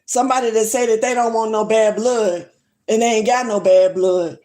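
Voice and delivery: ordinary talk, nothing marked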